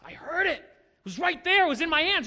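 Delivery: high, emphatic eyewitness insistence; confident testimony. Acted. Pastor imagines a believer testifying firsthand, high confident declaration. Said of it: "with confidence".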